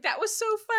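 very high, excited imitation; retold delight. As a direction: squeal it like a thrilled friend. Retelling friend's delight after practice run.

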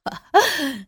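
a quick laugh, falling off; short laugh. A narrator's reading. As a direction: chuckle once before speaking. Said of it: "也笑着说".

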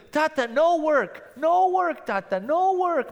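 put-on high, insistent little child voice; toddler pleading. Daughter begging daddy not to go to work, high childish plea. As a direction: beg like a tiny girl.

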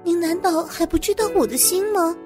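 earnest protest. Acted. Employee protests loyalty to the boss, earnest protest. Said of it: "我连忙正色道".

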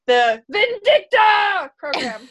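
slow dramatic shout of the program name; booming shout. Acted. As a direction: bellow the title like a movie trailer. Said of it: "I gotta stop saying that".

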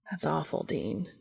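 soft, low, heartfelt sympathy; sincere. A narrator's reading. Castiel consoling Dean about his family, softly sincere. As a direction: drop low and quiet, and mean it. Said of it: "and he meant it".

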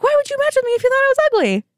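high fast exasperated retort; indignant. Real, not acted. Woman recalling the man who called her ugly, high exasperated disbelief. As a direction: throw the question back at him.

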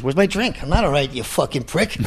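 high, tense tough-guy imitation; Pesci impression. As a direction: do Pesci losing his temper. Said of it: "And Pesci goes".

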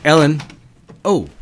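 loud, sharp call of a name; a boss barging in, calling loudly. Acted. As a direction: call her from across the room.

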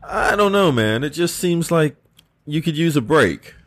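concerned king, gently urging rest; King Arthur voice. Acted.

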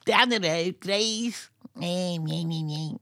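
high-pitched Scouse whine; Steve Gerrard. Acted. Showing the one Scouser he can do, high-pitched Scouse. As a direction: push it up into a high Scouse squeak. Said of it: "Steve Gerrard kind of".

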